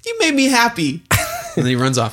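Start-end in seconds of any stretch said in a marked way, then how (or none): 0.0-1.0 put-on high, happy child; sweet little kid gushing; little boy voice. Son thanks father.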